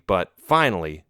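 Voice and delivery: ordinary delivery, nothing marked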